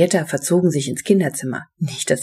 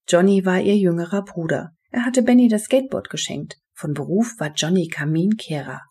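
usual speaking voice

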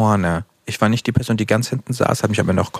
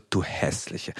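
softer, breathy teasing jab; playful insult; 5 semitones lower, 10 dB quieter, much slower than usual. Shown as a demonstration. Demonstrating her teasing tone to a friend, breathy and soft. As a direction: say it quietly, half-voiced, all affection. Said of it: "ja, so".

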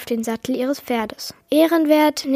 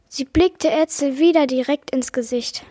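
ordinary delivery

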